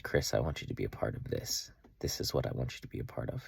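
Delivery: low, soft voice of God's call; hushed solemn quote. Retold or imitated. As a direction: speak it softly and low, as a personal calling. Retells God's personal call to him.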